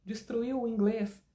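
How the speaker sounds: normal talking voice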